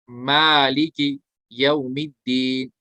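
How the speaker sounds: मालिक यौमिद्दीन recited slowly and drawn out; slow recitation. Shown as a demonstration. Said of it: "मालिकी में अलिफ मद्दा".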